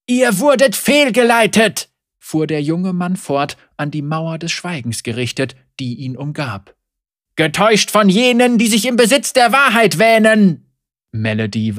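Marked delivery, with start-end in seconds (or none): 0.1-1.9 loud preaching. Acted. Young heretic preaching to crowd. 7.4-10.6 loud preaching. Acted. Young heretic preaching to crowd.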